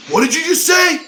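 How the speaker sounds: very high, loud outraged scream. Retold or imitated. Luke screaming at the reporter in the locker room, outraged scream. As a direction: scream it out like you're offended. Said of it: "like, scream".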